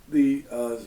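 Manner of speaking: ordinary delivery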